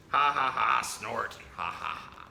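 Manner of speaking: mock laugh with a snort in the middle; silly chuckle, snort, chuckle; grandpa's funny noises. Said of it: "Grandpa's funny noises".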